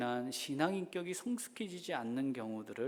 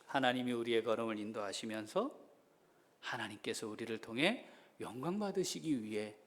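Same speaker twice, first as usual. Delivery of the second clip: normal talking voice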